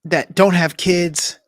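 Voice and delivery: hammed-up bragging dude; mock macho brag. Mocking a man's dating brag.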